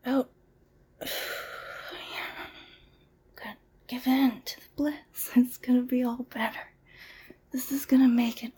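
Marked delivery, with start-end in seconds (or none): 1.0-2.5 slow whispered breath out; long exhale. Shown as a demonstration. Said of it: "Breathe in".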